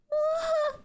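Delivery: short high yawn. Real, not acted. Streamer yawning along with chat. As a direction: let a quick high yawn out.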